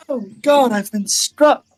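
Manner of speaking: high, theatrical mortally wounded act; hammed-up dying. Aerith, struck by a pretend arrow, melodramatic last stand. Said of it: "it hits Aerith in the shoulder".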